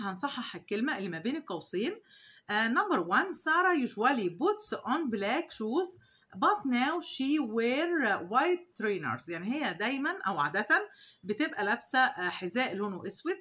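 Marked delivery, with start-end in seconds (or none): 3.3-9.3 very slow, clear reading of the exercise; slow dictation read. Shown as a demonstration. Teacher reading the exercise sentence slowly to the class. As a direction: read it out word by word for students. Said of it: "teacher reads exercise sentence slowly".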